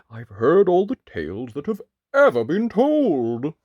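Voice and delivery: loud, halting giant voice; hesitant giant. Acted. Giant asking the wolf for a new book, hesitant.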